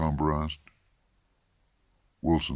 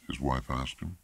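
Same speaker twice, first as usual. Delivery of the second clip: usual speaking voice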